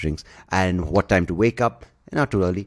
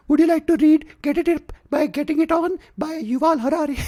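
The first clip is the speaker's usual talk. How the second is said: much higher, louder squeaky pitchman parody; mocking ad guy voice; 4 dB louder than usual. Mocking a smug YouTube ad guy's high pitch. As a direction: ham up the smug self-help guy. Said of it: "mimicking the ad voice again".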